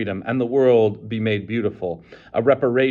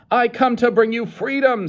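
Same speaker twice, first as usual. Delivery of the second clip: high, loud declaration; proclaiming; 13 semitones higher, 5 dB louder than usual. A narrator's reading. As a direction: announce freedom. Savage proclaims.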